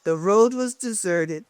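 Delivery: usual speaking voice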